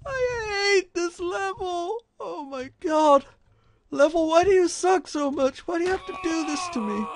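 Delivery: high, tearful-sounding wail; whining despair. Real, not acted. Gamer begging the level to stop, whining despair. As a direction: whine and wail at the game. Rants at the level after failing.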